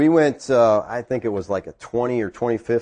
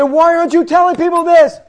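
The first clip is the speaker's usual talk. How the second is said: very high, loud demand; impassioned challenge; 9 dB louder than usual. Retold or imitated. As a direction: confront the preacher at full voice. Retold confrontation with the preacher.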